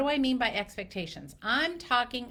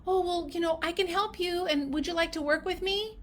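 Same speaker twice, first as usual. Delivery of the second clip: higher, eager-to-please offer; tentative people-pleasing; 6 semitones higher than usual. Acted. Clinician hesitantly asking a patient to work together, tentative. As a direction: pitch it up, hopeful and unsure. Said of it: "we sometimes come at it like".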